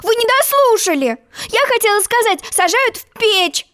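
put-on high, heated girlish protest. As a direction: protest hotly, high-pitched. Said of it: "горячо запротестовала".

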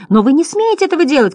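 high, quick protest; Bastian shouting. A narrator's reading. Boy to the clown butterflies, high indignant cry. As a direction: cry out a hurried objection. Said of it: "кричал Бастиан, борясь со смехом".

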